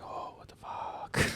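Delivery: slow, hushed mock dismay; stunned whisper. Host retelling finding drugs in pocket, stunned whisper. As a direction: breathe it out slowly, barely voiced.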